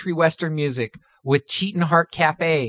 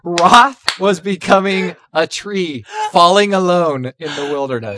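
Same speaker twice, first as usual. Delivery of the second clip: laughing while reading; 2 semitones higher, 4 dB louder than usual. Real, not acted. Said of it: "I gotta hold it together".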